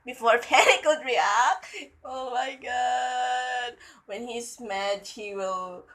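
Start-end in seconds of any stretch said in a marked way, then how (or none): 2.0-3.7 slow, softer drawn-out groan; swooning. Real, not acted. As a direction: stretch every word in a swoon. Reader swoons at the line.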